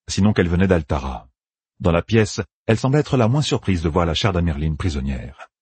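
usual speaking voice

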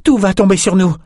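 high, urgent warning; alarmed cry. A narrator's reading. Companion warns that the vault is collapsing, high alarmed cry. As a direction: cry out as the danger hits. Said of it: "cria Nietzsche".

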